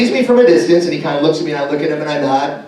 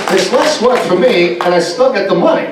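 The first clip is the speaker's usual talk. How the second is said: Walken impression; slower than usual. Voicing Walken.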